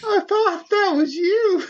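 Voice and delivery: high wailing cry; Susie Soccer crying. Acted. Host voices Susie Soccer sobbing over her friend, high pitched. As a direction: wail his name like a heartbroken girl.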